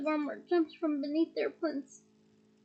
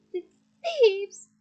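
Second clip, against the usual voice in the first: stammered; 5 semitones higher, 8 dB louder than usual. A narrator's reading. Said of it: "stammered Filch".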